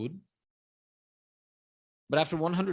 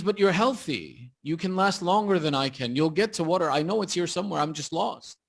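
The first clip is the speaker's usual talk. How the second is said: retold plea, higher and louder; urgent pleading; 5 semitones higher, 6 dB louder than usual. Lost hermit begs a traveller for water, urgent pleading. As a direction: press the case like a desperate hermit, lightly acted.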